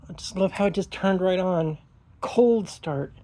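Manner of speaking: normal talking voice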